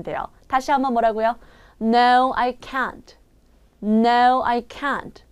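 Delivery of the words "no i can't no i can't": "can't" squeezed and nearly without T. Shown as a demonstration. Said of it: "약간 쥐어짜는 듯이 발음하고". ("can't"를 쥐어짜고 T는 살짝)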